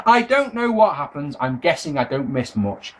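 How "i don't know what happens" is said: ordinary talk, nothing marked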